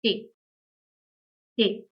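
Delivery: "te" said as German T, teh; German letter name. Shown as a demonstration. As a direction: say "te" as an isolated long teh. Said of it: "is pronounced as".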